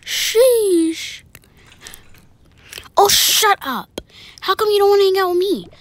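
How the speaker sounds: high, loud, exasperated snapping; indignant quarrel. Acted. Quarrel after being turned down.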